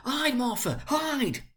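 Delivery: little ones hurrying their sister; urgent children. A narrator's reading. Children telling Martha to hide, high urgent voices.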